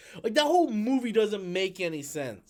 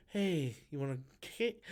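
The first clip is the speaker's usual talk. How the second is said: mocking the actress; 6 semitones lower, 11 dB quieter than usual. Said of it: "sit there and go".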